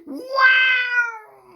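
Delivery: very high, loud yowl of shock; meowing cats. Acted. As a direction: cats yowling in disbelief. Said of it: "meowed the cats".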